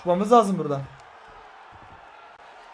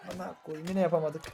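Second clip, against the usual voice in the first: muttered curse; 8 dB quieter than usual. Real, not acted. Failed move, muttered curse.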